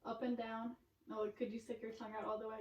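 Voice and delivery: ordinary talk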